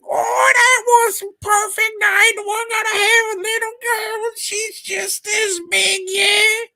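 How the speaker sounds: very high, loud squealing put-on accent; mock annoying actress. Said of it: "she says the same thing".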